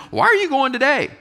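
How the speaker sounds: squeaky disbelieving question; puzzled husband. Retold or imitated. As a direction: ask it like a baffled husband, high. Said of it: "So his question is".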